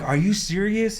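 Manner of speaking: high, excited disbelief; amazed question. Retold or imitated. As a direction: ask it thrilled and stunned. Reacting to the acceptance email.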